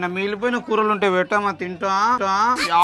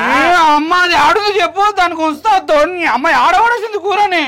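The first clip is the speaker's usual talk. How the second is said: higher and louder, nagging his mother; whiny demand; 5 semitones higher, 5 dB louder than usual. Acted. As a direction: pester her for the curry. Son whines at mother for curry.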